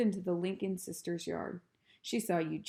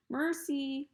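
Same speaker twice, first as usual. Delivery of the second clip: calling; 8 semitones higher than usual. A narrator's reading. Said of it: "Missus Watson called".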